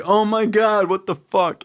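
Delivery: high startled outburst; shocked. Retold or imitated. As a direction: jolt up in sudden shock. Discovering jellyfish on hand.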